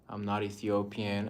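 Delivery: usual speaking voice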